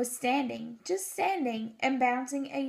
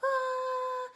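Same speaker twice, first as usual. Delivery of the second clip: tiny squeak; 12 semitones higher than usual. Pretend. Tiny voices test sound.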